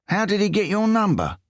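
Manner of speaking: high, whiny English voice; whiny Englishman. A narrator's reading. English thief quizzes Riso in a high whiny voice. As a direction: push it up into a whine. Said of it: "almost whiny tone".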